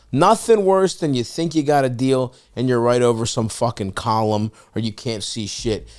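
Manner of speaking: ordinary talk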